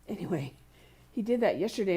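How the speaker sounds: usual speaking voice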